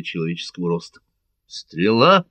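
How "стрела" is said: louder, urgent call of alarm; warning cry. A narrator's reading. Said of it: "крикнул каталонец".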